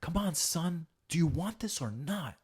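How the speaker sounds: high, punchy pep talk; acted rousing dad, pitched well up; motivational father. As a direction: fire up your son like a coach. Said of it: "you gotta be more motivational".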